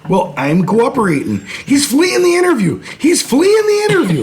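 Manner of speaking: a squeaky Minnesota cop line from Fargo; Fargo-style Minnesota accent. Acted. Joking with friends in a high Minnesota accent. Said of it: "He's dead on on the accent".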